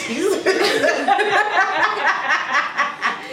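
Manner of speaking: high, loud laughing fit; long laugh. Real, not acted. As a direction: burst out laughing. Friend laughs hard.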